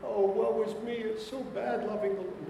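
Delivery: high, whiny mock lament; mock self-pity. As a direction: ham up a feeble moan. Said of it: "What are they doing?".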